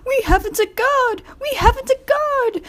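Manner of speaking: squeaky high alarmed carriage; anxious coach. A narrator's reading. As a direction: plead to be heard. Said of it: "Annie tried to tell Thomas".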